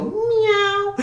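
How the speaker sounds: high, stretched meow; cat meow. Shown as a demonstration. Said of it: "my best cat impression".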